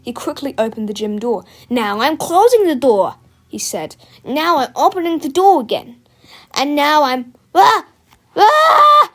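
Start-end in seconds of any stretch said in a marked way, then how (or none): 1.7-3.2 loud mocking announcement; taunting. A narrator's reading. Teacher taunts boys at door. 4.2-7.3 high, loud mocking announcement; taunting. A narrator's reading. Teacher taunts, then is seized. 7.5-9.1 high, loud scream trailing into gurgles; terrified scream. A narrator's reading. Teacher eaten by toilet.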